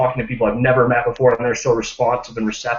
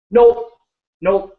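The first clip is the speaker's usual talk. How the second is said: flat refusal; 7 semitones higher, 5 dB louder than usual. Retold or imitated. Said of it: "And I go".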